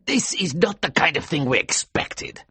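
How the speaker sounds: angry. A narrator's reading. Company man rejecting Kurtz's paper, angrily. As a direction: snap it out as an offended company man. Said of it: "he told me angrily".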